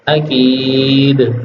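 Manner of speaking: عقید drawn out very slowly, ending on a stopped د; pausal form. Shown as a demonstration. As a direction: stop on عقید, slow and falling. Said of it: "اس کو ٹھہرا کے پڑھیں گے تو". (عقید پر وقف، آہستہ)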